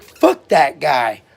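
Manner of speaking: mock-angry outburst, loud and drawn out; retold fed-up curse, punched hard and slow; angry dismissal. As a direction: loudly imitate a friend writing someone off. Retelling friend's angry reaction.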